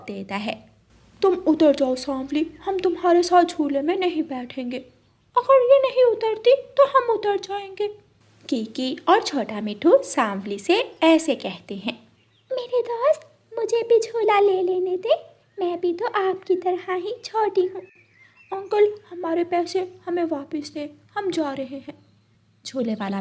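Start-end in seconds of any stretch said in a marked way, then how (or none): 1.2-7.9 put-on high, snooty kids' voices; bratty children. Children refuse to share swing. 12.5-21.9 pleading little bird. Acted.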